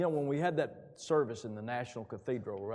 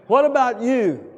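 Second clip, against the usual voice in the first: slow, loud, high WHAT hammered home; thundering preacher's question; emphatic; 12 semitones higher, 15 dB louder than usual. Acted. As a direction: demand an answer, slowly.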